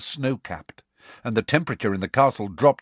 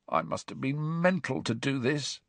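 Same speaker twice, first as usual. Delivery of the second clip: half-whispered through a dry throat; croaky whisper; 5 semitones higher, 6 dB quieter than usual. A narrator's reading. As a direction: Ron croaking his regret into a near whisper. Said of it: "he said in a croaky whisper".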